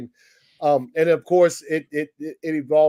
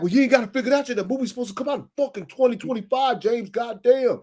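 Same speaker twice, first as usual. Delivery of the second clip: annoyed fan; 7 semitones higher than usual. Retold or imitated. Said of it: "The people gonna come back".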